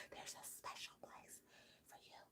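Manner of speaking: whispered, almost no voice; soft whisper. Retold or imitated. Persephone repeats her curse in a whisper. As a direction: hush it out like a secret curse. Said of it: "why is she whispering".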